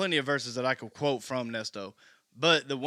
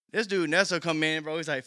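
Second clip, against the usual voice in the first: normal talking voice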